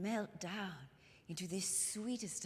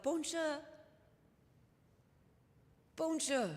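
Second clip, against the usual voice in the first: "Bonjour, bonjour" sung out higher with a French lilt; French soldiers greeting; 7 semitones higher than usual. Retold or imitated. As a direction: call a cheerful French "Bonjour" across the street. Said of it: "And they would call out".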